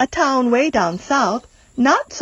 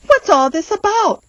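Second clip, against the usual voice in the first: much higher excited shout; 10 semitones higher, 3 dB louder than usual. Acted. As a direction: call out in wonder. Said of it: "the people came shouting".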